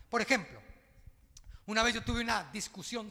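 loud, rising preacher's cry; full-voiced pulpit preaching; fiery preaching. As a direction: drive the point home to the congregation. Pastor preaching at full force.

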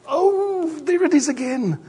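high whiny "oh, there it is again"; mock groan. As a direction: play the sulky reluctant listener. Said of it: "It's like".

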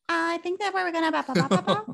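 squeaky high babbling voice; silly high voice. Shown as a demonstration. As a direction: babble it like a cartoon. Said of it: "which one?".

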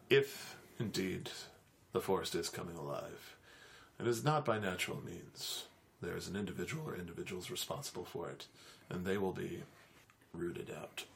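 low, soft, grave threat; ominous warlock. Acted.

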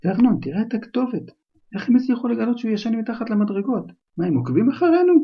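anxious aunt. Acted. Aunt frightened over the address.

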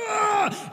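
high, loud mock war cry; toddler battle yell. Toddler hurling a boulder at the greenhouse, mock war cry. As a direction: heave it like a tiny Hulk. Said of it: "He's just like".

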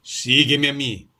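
firm command. A narrator's reading. Arkady commands his friend.

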